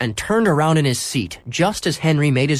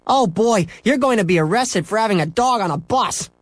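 put-on higher, gleeful kid gloating; 9 semitones higher than usual. Scooter gloating at Henry about arrest, gleeful. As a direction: rub it in with glee. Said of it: "gloated Scooter".

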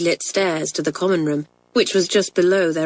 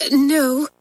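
high, timid little answer; rather trembling; 8 semitones higher than usual. A narrator's reading. Pat to Mamselle, caught out, rather trembling. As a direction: answer with a slight nervous tremble. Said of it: "in a rather trembling voice".

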